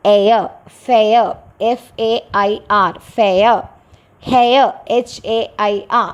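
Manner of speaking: എയർ, ഫെയർ, ഹെയർ gliding "eh" into "uh"; example words for എയ. Shown as a demonstration. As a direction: model the "eh-uh" glide in ഫെയർ, then spell it out. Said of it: "എന്ന് പറയുന്ന sound വരുന്നതോ".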